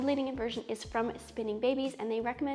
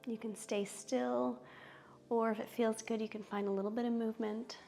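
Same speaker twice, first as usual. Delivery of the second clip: soothing yoga teacher; 6 dB quieter, slower than usual. Acted. Guides yoga poses slowly.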